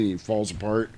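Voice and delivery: ordinary talk, nothing marked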